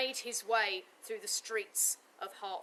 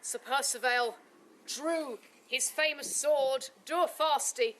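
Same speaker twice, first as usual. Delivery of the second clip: normal talking voice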